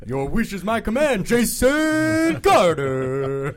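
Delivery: slow, drawn-out trailer voice; movie announcer voice. Acted. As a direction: grant the wish like a trailer narrator. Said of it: "talk like that the rest of the night".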